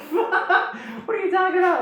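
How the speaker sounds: high, loud laughing disbelief; mock incredulous laughter. Nuju laughing off Vakama's story, mock incredulous laughter. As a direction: burst out laughing through the question.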